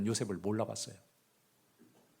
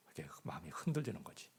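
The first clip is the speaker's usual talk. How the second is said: whisper; 5 semitones lower, 9 dB quieter than usual. Real, not acted. Preacher confiding doubt.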